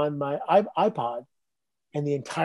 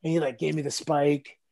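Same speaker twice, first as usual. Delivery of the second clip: ordinary talk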